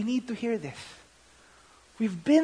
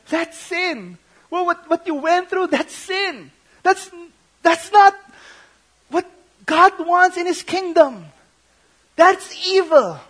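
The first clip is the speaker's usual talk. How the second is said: higher, louder retold protest; mild impassioned retelling; 9 semitones higher, 5 dB louder than usual. Counselor telling an abused young man it was sin, higher and louder. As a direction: retell it as the counselor's earnest outcry.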